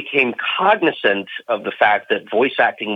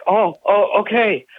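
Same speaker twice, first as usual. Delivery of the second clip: surprised; 9 semitones higher, slower than usual. Retold or imitated. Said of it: "I'm like".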